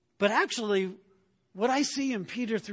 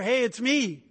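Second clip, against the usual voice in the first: raised, higher call to reassure; 6 semitones higher, 7 dB louder than usual. Retold or imitated. Jesus to frightened disciples in their boat, calling out. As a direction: call out loudly, as if across water. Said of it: "yells out to them".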